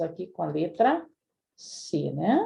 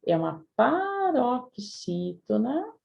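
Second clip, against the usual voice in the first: normal talking voice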